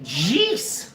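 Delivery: usual speaking voice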